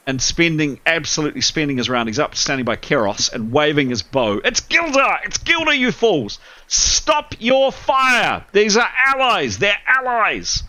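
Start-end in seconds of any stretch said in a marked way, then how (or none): 4.4-10.6 Gildar yelling. Acted. Archer yelling at the tower to stop firing, frantic high yell. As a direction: shout them down to stop firing. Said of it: "he's certainly yelling at the top of his voice".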